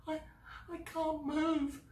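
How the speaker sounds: wail, too scared to move. Acted. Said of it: "Sylvie wailed".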